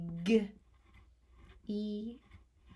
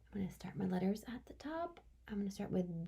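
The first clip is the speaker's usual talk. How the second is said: whisper; 11 dB quieter, faster than usual. Real, not acted. Teacher whispering while writing.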